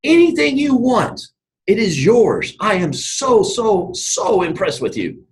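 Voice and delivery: high, slow, effusive royal praise; impressed king. Retold or imitated. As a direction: gush slowly like an awestruck king. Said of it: "the king's looking, it's like".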